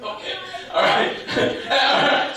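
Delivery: usual speaking voice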